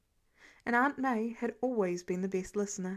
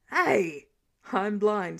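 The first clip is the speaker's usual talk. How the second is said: indignant; 5 dB louder, much slower than usual. Acted. Indignant protest.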